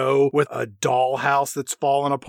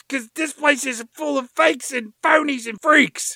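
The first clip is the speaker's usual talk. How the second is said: higher, ranting threat; mock screaming rant; 9 semitones higher than usual. Threatening to sue the town, hammed-up ranting. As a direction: rail at the whole town like a wronged TV host. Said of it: "he starts screaming out at the people".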